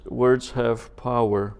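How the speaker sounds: ordinary delivery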